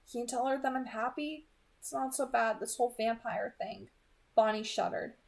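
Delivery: calm, even audiobook reading; soft storytelling voice; story narration. A narrator's reading. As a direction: read the chapter steadily to listeners. Reads the novel chapter aloud.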